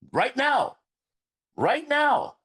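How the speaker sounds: high, loud, urgent call to act now; urgent shout. Retold or imitated. Man telling his wife he must help his friend, high loud urgency. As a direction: pitch it high and punch each right now. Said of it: "I was like".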